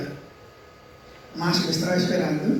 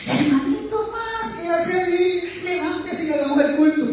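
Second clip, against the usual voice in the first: imitated taunting callers; 12 semitones higher than usual. Two hostile inmates find him crying and call him to worship, in high singsong. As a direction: call out in a put-on high voice, stretching the words.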